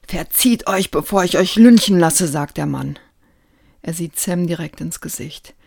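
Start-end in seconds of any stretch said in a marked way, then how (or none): 0.1-2.3 put-on loud furious threat to the children; angry threat. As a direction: yell them out of the bank. Bank man threatens the children. (wütende, laute Drohung)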